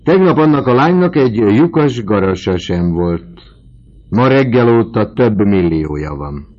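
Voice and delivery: loud, high, emphatic announcement; triumphant proclaiming. A narrator's reading. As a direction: trumpet the sudden fortune across the table. Vautrin trumpets the girl's sudden millions.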